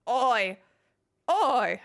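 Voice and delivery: loud, rough Australian shout of oi; Australian accent shouted oi. Pretend. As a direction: bark it like an Aussie horse. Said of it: "I make every accent say oi".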